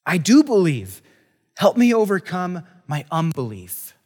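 father crying out to Jesus, loud and desperate; exclaiming. Acted. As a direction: plead it out, a father at the end of his rope. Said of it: "the boy's father exclaimed".

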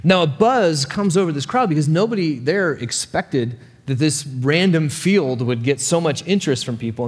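usual speaking voice

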